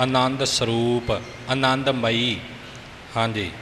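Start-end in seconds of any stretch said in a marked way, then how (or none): none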